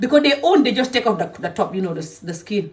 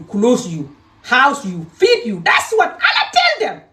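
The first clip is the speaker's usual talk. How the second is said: angry; 3 semitones higher, 3 dB louder, slower than usual. Real, not acted. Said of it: "these people make me angry".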